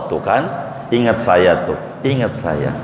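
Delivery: low knowing voice. Acted.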